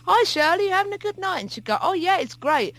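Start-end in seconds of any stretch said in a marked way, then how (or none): 0.1-1.4 chirpy journalist. Retold or imitated. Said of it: "put out a tape recorder in front of her and say". 1.8-2.7 put-on high chirpy quote, no Scottish accent; bright mock celebrity reply, no accent. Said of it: "I won't do the Scottish accent".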